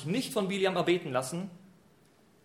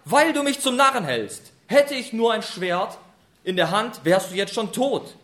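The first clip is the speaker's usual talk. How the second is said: loud, furious threat; 3 semitones higher, 7 dB louder than usual. Retold or imitated. Biliam in a rage threatens the donkey with a sword. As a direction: spit out the threat, enraged. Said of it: "wie zornig er ist".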